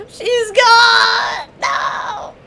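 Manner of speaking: high, loud cry of grief; real grief bursting out loud; distraught cry. Real, not acted. Streamer crying out to chat as Lucatiel dies, distraught loud cry.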